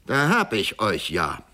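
calling out. A narrator's reading. Executioner hailing Georg Kindler on the path, calling out. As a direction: call out as if catching an old foe. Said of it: "rief der Scharfrichter".

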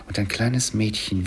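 ordinary delivery

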